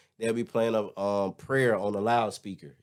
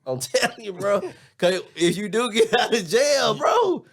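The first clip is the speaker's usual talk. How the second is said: excited laughing shout; 10 semitones higher than usual. Real, not acted. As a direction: blurt it out laughing.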